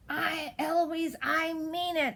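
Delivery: high, drawn-out exasperated shout; tutor's shout. A narrator's reading. Tutor at wit's end with Eloise, high shout. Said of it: "And then he shouts".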